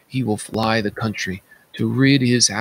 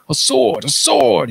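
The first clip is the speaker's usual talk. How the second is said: high, louder desperate cry for a sword; frantic cry; 14 semitones higher, 6 dB louder than usual. A narrator's reading. King raving among his servants, crying out frantically. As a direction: shout it like a man gone mad. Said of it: "he cried".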